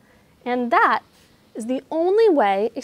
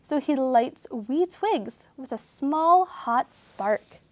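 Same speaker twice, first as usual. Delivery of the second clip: storybook reading; 2 semitones higher, 4 dB louder than usual. A narrator's reading. Reading a sleepy bear story to children, storybook voice. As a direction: read it like a bedtime picture book. Reads picture book aloud.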